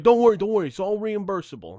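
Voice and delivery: high, loud imitation of hasty reassurance; placating tow guy. Tow driver soothing angry customer, hastily placating. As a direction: cut in to head off the complaint.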